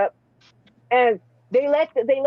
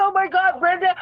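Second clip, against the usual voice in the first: higher, shouted, scandalized laugh-shock; shocked outcry; 8 semitones higher than usual. Retold or imitated. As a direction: blurt it out in disbelief. Shocked at Brenda flirting.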